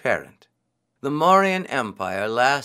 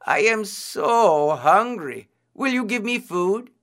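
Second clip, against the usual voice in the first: crying out in hunger; 9 semitones higher, 3 dB louder than usual. A narrator's reading. Disguised priest begging the jackal for food, pleading. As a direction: draw out the hunger, then ask high. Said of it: "he cried".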